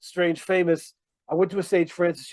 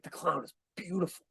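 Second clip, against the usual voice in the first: retold quiet marvelling; hushed awe; 13 dB quieter than usual. Fan marvelling at the clown amid booing, hushed awe. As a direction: drop soft, as if awestruck.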